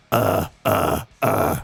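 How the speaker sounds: moderate rasping growl; mic-level growl. Shown as a demonstration. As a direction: rasp at microphone level. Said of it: "you're going into a microphone".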